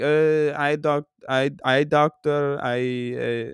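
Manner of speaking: put-on halting, vague European accent; put-on Euro trash accent. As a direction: fumble for words in a fake Euro accent. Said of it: "in my Euro trash accent".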